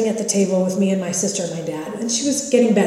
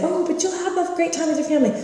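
mock cheery; 9 semitones higher than usual.

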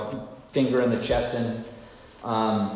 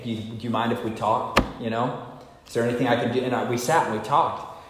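ordinary talk

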